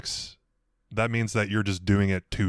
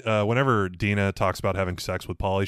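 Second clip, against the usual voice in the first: ordinary delivery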